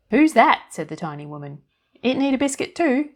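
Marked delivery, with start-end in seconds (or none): 0.1-0.7 put-on high, loud, chirpy little old woman; fairy godmother voice. A narrator's reading. Tiny woman in the biscuit tin greeting Annie, high and chirpy. 2.0-3.1 put-on high, loud, chirpy little old woman; fairy godmother voice. A narrator's reading. Tiny woman in the biscuit tin greeting Annie, high and chirpy.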